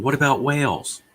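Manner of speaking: high mock objection; mock protesters. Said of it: "three people going".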